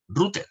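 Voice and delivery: "router" dicho a la española, como rúter; pronunciación españolizada. Shown as a demonstration. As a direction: say "router" as Spanish RU-ter. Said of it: "nosotros ya la hemos españolizado y nosotros pronunciamos". ("router" españolizado, con u tónica)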